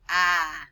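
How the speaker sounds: a loud open "Ah."; saying ahh. Shown as a demonstration. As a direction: open the mouth wide on "Ah.". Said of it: "Can you say that? Repeat".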